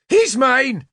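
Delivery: high, commanding cry; Nimue's shout. A narrator's reading. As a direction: stop him, claim the kill. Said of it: "Nimue shouted at me".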